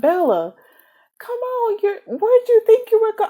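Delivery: hammed-up Edward pleading with Bella; mock Edward voice.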